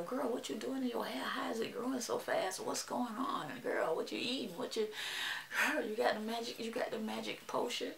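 half-whispered imitation of curious viewers; hushed nosy questioning. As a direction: ask it softly, like prying for a secret. Voices curious viewers asking her secret.

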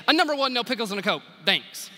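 rattled off fast, no pause between items; shot back quickly. Retold or imitated. Drive-thru customer firing her order back, quick and curt. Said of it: "shot back quickly".

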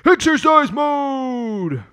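high, slow dramatic call; hammy announcement. Pretend. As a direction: stretch it out like a game show host. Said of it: "Let's put on exercise mode".

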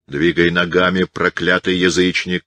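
ordinary delivery

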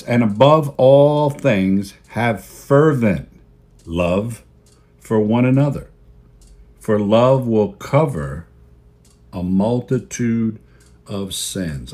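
slow, solemn reading of the verse; scripture reading. Acted. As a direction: read the verse with weight and reverence. Scripture read aloud slowly.

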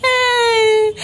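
a sudden high wailing shout; high crying yelp. Acted. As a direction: cry out in distress. Bird cries out at dog.

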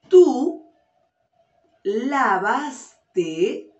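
teacher lays the sentence out word by word; slow clear dictation. Shown as a demonstration. Teacher dictating a sentence to her class, slow and clear. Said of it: "Escucha bien".

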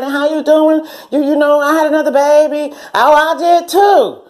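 put-on high, bright girlish chatter; chatty catch-up. Old friends catching up, high excited chatter. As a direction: swap baby news excitedly.

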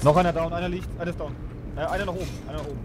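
urgent high callouts; panicking gamer. Real, not acted. Frantic callouts during the fight.

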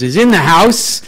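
loud, high celebratory announcement; excited hype shout. Real, not acted. Host announces Tracy Morris to the room, excited hype shout. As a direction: whoop up the crowd for the star guest. Host hypes the guest's arrival.

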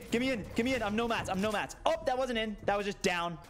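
ordinary delivery

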